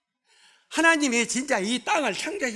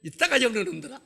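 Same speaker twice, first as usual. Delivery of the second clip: joking mock scolding; faster than usual. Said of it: "나도 그렇게 한번 보내볼까".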